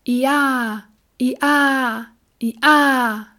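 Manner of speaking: loud high hee-haw brays; donkey braying. A narrator's reading. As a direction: bray it three times like a donkey. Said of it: "stieß Benjamin dreimal kräftig".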